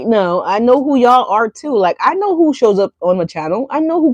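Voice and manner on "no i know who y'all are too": usual speaking voice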